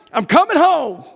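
high and loud, full of joy; rejoicing cry. Acted. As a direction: shout it with overjoyed certainty. Rejoicing dying believers.

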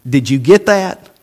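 ordinary talk, nothing marked

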